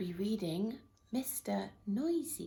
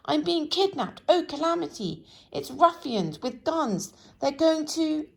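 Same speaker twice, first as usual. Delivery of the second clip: much higher and louder alarm; panicked screaming; 8 semitones higher, 8 dB louder than usual. A narrator's reading. Mister Jelly thinks ruffians have come, screaming high. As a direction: shout out the danger in terror. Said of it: "he screamed".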